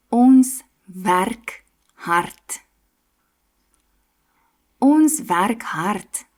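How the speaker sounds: Ons werk hard stretched very slowly, R rolled; very slow demo. Shown as a demonstration. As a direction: drag it out for learners. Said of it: "R sound in a few sentences".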